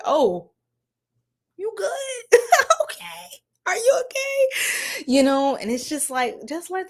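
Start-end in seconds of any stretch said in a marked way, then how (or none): 0.0-5.0 mock concerned check-in. Mocking a worried partner checking in.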